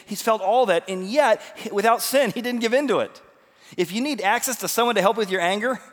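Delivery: high, loud, driving sermon delivery; fired-up preaching. Acted.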